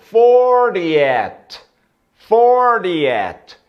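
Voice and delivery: fortieth said high and loud, careful model; teacher models fortieth for the class; model pronunciation. Shown as a demonstration. Teacher drilling students, careful model word. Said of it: "Quadragésimo".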